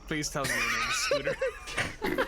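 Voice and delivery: Scooter impression. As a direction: squeak it out like Scooter. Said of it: "that's a really good Scooter impression".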